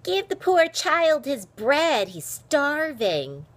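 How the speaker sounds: high, slow, mock-pitying jeer; loud drawn-out teasing. A narrator's reading. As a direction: stretch it out in fake sympathy. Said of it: "Aaron mocked her".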